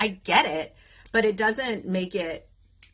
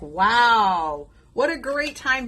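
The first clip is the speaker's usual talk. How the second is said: ironic wonder with a drawn-out wow; mock amazement; 3 semitones higher, 5 dB louder, much slower than usual. Single mom on losing her job as college starts, mock amazement. Sarcastic reaction to badly timed layoff.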